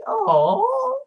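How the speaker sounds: high cooing voice to a pet; fond high baby talk to a kitten. Pretend. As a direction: coo at the little destroyer like a baby. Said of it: "it's just kind of like".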